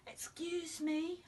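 high, small, soft piping voice; piped. A narrator's reading. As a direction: pipe it up like a tiny man. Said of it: "I piped".

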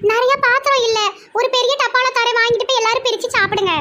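Mumtaz voice. Acted. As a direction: pipe up in a high, proud voice.

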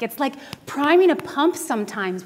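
ordinary talk, nothing marked

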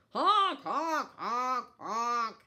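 loud high honks; geese honking. A narrator's reading. Geese answering the kittens with loud honks. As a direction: honk like angry geese. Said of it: "But the geese went".

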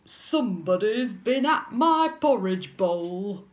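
loud, growling big bear; booming angry bear growl; Daddy Bear. A narrator's reading. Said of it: "Daddy Bear growled".

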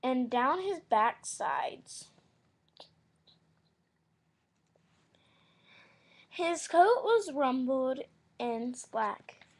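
loud, slow read-aloud narration; story reading. Acted.

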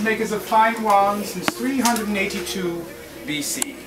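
normal talking voice

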